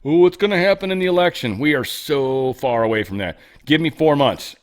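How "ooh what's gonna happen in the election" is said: hammed-up fretting, louder and higher; mock the worried crowd; fussy mock worry; mocking anxious voter. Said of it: "let's not get hung up on".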